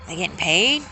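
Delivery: ordinary delivery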